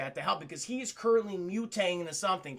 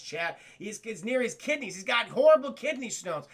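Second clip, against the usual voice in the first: ordinary talk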